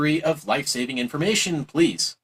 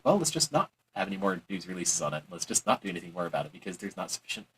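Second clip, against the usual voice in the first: mock dismissive mumble; 8 semitones lower, 9 dB quieter than usual. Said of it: "they'll just be like".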